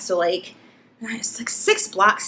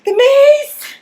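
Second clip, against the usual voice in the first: high, loud, panicked cry; blinded by mace, yelling to the boyfriend in panic; 14 semitones higher, 8 dB louder, much slower than usual. Retold or imitated. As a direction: yell it out like it burns. Retelling panicked cry at sink.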